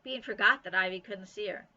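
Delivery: ordinary delivery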